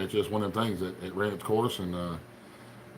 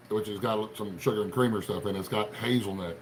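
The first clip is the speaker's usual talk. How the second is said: usual speaking voice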